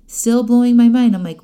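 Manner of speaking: ordinary talk, nothing marked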